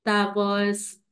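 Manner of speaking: normal talking voice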